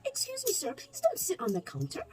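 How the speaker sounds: high, sharp excuse me to the customer; half-mock stern warning; polite scolding. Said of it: "I said".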